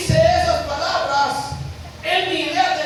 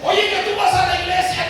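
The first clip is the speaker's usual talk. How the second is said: higher, louder calling out; loud accusation; 5 semitones higher, 5 dB louder than usual. Acted. Servant woman accusing Peter, calling out loud. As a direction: point the finger out loud.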